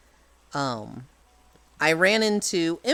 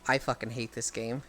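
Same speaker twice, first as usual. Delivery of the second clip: muttered under breath; 6 semitones lower, 9 dB quieter than usual. Retold or imitated. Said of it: "I'm like".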